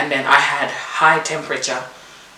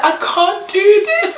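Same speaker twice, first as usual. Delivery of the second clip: retold crying; 3 dB louder, slower than usual. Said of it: "I'm crying".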